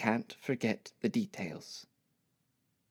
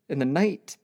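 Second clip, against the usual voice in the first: usual speaking voice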